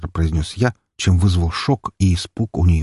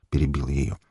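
usual speaking voice

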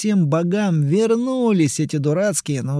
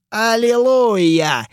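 mock hallelujah; 7 semitones higher, 4 dB louder, slower than usual. Hero mocks her admission.

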